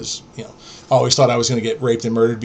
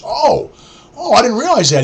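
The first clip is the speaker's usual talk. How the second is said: feigned surprise; 9 semitones higher, 3 dB louder than usual. Retold or imitated. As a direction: imitate a schemer acting innocently surprised. Friend feigning surprise at no money.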